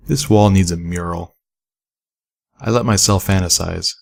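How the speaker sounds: ordinary delivery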